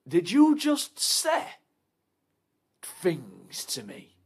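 slow, with a high start sinking to a whisper; slow menace. Acted.

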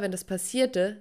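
plain reading voice

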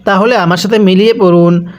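normal talking voice

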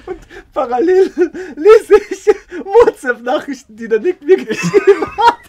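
high, helpless laughter through the words; laughing while talking. Real, not acted. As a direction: barely get it out for laughing. Cracking up over the story.

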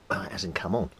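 ordinary talk, nothing marked

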